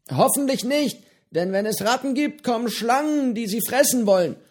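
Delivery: höhere, lockere Piratenstimme, freundlich plaudernd; Johnny, der alte Pirat, prahlt gemütlich mit seinem Fisch; gutmütiger Pirat Johnny. A narrator's reading. Johnny warnt vor Schlangen. (höhere Stimme eines gutmütigen alten Piraten)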